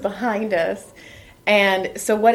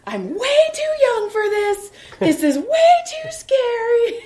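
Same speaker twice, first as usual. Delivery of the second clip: put-on high, whiny frightened kid voice; imitating a terrified child, pitched way up; scared kid; 14 semitones higher than usual. Mom imitating her scared son on the coaster, high whiny panic. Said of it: "And he goes".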